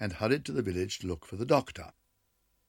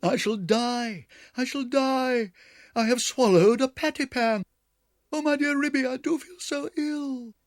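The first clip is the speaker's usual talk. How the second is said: howling; 7 dB louder than usual. A narrator's reading. Duchess howling to Ribby she swallowed the pan, wailing. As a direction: wail as if dying. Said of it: "Duchess set up a howl".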